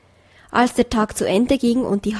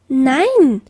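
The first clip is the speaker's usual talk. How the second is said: protesting no; 3 semitones higher, 5 dB louder than usual. A narrator's reading. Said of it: "riefen die Füchse".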